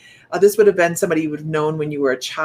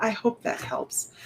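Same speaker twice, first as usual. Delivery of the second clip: hushed closing; 4 semitones higher, 6 dB quieter, slower than usual. Shown as a demonstration. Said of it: "Shifting gears like that".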